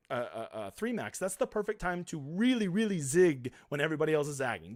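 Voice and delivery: usual speaking voice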